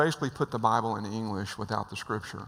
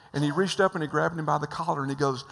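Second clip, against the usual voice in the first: usual speaking voice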